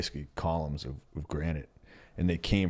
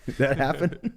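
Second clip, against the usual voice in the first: laughing, high and louder; 9 semitones higher, 4 dB louder than usual. Real, not acted. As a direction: laugh through the question. Laughing at listener comment.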